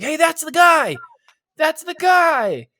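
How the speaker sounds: high, loud, drawn-out call; excited passer-by shout. Acted. Some dude walking up spots the guy, high shout. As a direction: yell it as if spotting someone. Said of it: "some dude ... they're like".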